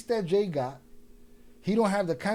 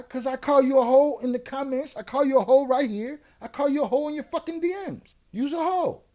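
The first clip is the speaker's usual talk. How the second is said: high, raised-voice name-calling; retold angry taunt; 7 semitones higher, 5 dB louder than usual. Telling off a man he called out in the comments, angry taunt. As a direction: hammer each repeated insult, pitch climbing. Retelling his own confrontation with the man.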